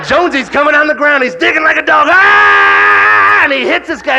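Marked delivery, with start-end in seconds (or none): 2.1-3.4 charging yell. Retold or imitated. Teammate charging in, shout heard.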